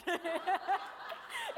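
loud burst of embarrassed laughter; real laugh, loud and high. Real, not acted. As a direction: laugh at your own failed accent. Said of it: "It was bad".

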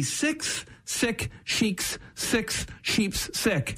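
crawl through it softly; careful slow recital; very slow twister. Shown as a demonstration. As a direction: spell it out very slowly. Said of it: "the hardest tongue twister".

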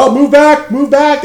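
high, loud shout to move back; riot police shouting orders. Retold or imitated. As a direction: bark the order to clear the street. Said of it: "all of a sudden".

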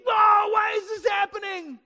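high, shouted mock wail; flipping out. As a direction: wail it loudly in hammed-up panic. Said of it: "flip out like the king".

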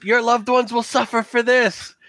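high, strained yell at a crowd; yelling threat. Acted. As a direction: hurl it at the villagers as a threat. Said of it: "he starts yelling to the villagers".

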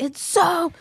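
high, loud gushing protest, easing off toward the end; loud, high-pitched defensive gush; pleading defence. Retold or imitated. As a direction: plead the case for something you love. Defending show to teasing friends.

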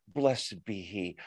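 hushed, low, slow pious formula. Retold or imitated. Pharisees after mentioning the Lord, pious hush. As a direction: drop down into a soft, drawn-out blessing. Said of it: "They're so holy".

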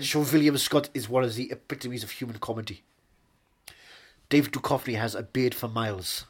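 put-on measured German-accented English; German film-director accent. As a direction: philosophize in a thick German accent.

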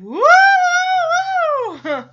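high, loud, drawn-out "woo"; imitated audience whooping. Imitating the sitcom audience reacting to a flirtation, whooping. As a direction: whoop like a scandalized studio audience. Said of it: "audience going".

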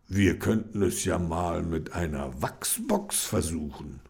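Reggie voice. Acted. Reggie proposes a growth box, quiet.

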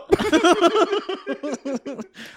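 high villain cackle; high cackling laugh. Pretend. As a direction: cackle like a mad shopkeeper. Soda jerk character cackle.

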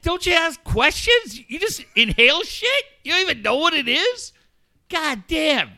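high, incredulous telling-off; imitated scolding. Doctor friend scolds him for taking it, mock scolding.

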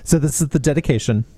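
normal talking voice

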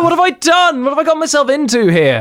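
a high, alarmed inner voice; mock panic. A boy realizing the old man is a bigot, mock panic. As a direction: squeak it out in mock dread.

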